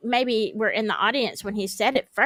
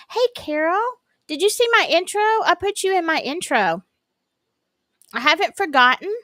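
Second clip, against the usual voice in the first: higher, eager greeting to a viewer; excited calling out; 6 semitones higher than usual. Real, not acted. Greeting Carol in chat, eager and raised. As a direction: call out happily to Carol. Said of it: "Am I yelling, y'all? I'm probably yelling".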